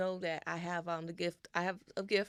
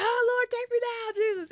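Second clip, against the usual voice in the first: high, loud mock-panicked wail; hammed-up desperate plea, pitched way up; freaking out; 10 dB louder than usual. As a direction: cry out to heaven in comic terror. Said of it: "and I'm freaking out".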